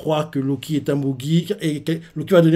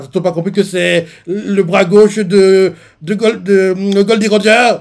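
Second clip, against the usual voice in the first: higher, louder, slower mock exclaim; Luffy exclaiming; 5 semitones higher, 6 dB louder than usual. Luffy explains who Shanks is, loud insistent exclaim. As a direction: press the point hard. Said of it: "Lui fait".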